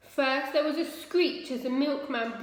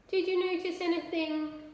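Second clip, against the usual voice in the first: quick question; 7 semitones higher than usual. A narrator's reading. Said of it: "asked Ma quickly".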